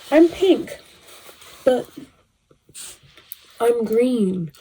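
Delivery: emphatic declaration. Real, not acted. Telling viewers she's pink, high proud claim. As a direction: declare it with pride. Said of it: "it's about to get real".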